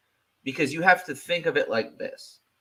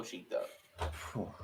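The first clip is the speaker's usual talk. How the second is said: whispered; 5 semitones lower, 16 dB quieter than usual. Real, not acted. Whispered aside joke.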